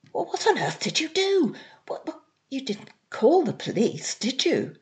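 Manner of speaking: high, breathless disbelief; shocked. Acted. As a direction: gasp it out in horror. Kevin shocked by confession.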